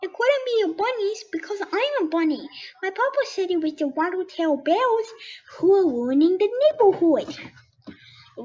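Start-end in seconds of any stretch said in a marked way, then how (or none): 0.0-7.3 squeaky little bunny asking, higher pitch. Acted. Bunny questions the skunk, high and curious. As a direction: play a small excited bunny, pitched up. Buttermilk giggles and explains.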